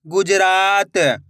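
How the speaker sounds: slow, drawn-out blending of the word; slow clear reading. Shown as a demonstration. Teacher to pupils, blending the word slowly. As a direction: stretch each syllable for the pupils. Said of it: "मैं किस तरीके से एक एक अक्षर का उच्चारण".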